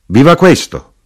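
high, loud cheer; shouted toast. A narrator's reading. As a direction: cry out a toast to the room. Knife-grinder answers with a toast.

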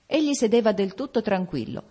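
ordinary delivery, nothing marked

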